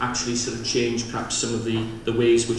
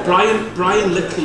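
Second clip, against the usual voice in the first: excited; 7 semitones higher, 7 dB louder, slower than usual. Real, not acted. Joking aside to the audience.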